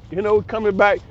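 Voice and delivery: usual speaking voice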